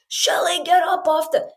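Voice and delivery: high call to wake her. Retold or imitated. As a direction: yell up the beach to her. Calling Shelly from the water.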